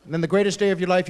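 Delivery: ordinary talk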